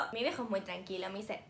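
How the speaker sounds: ordinary delivery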